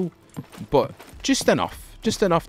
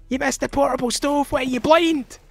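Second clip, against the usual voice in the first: mock angry commenter yell; 13 semitones higher than usual. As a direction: yell at the streamer like an outraged fan. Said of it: "shout at me in the comments".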